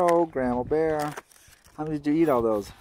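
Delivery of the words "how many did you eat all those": ordinary delivery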